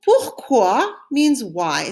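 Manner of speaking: Pourquoi means why, stretched slowly; slow, clear. Shown as a demonstration. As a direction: teach it slowly. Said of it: "Say it after me".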